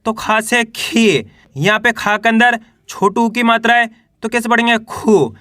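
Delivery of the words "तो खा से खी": खा से खी slowly, long ee in खी; slow demo. Shown as a demonstration. Teacher slowly showing students खा becoming खी. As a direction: spell it out for pupils. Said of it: "बड़ी ई की मात्रा है".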